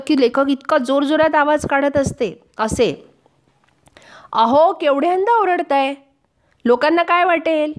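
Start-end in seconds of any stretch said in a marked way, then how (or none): 4.3-7.7 higher voice of a wife scolding her husband; wife nagging the husband who yells over his toothache, scolding. Retold or imitated. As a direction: tell him off like an exasperated wife. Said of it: "अशी दमदाटी बायकोशिवाय कोण करणार?".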